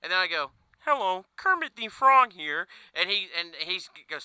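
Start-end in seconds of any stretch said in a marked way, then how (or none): 0.8-2.7 high, slow puppet-frog greeting; Kermit the Frog voice. Pretend. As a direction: announce yourself like the frog puppet. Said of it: "complete just non-Kermit voice".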